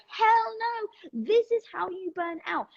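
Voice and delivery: high-pitched, insistent self-interruption; emphatic interrupter. Acted. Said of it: "this interrupter that is like".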